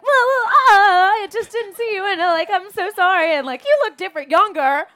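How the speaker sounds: high, loud, flustered imitation of an embarrassed man; flustered brother. Guest mimicking embarrassed co-star apologizing, high flustered voice. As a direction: babble the apology in a high panicked rush. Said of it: "he gets so embarrassed".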